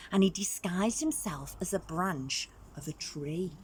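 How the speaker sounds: usual speaking voice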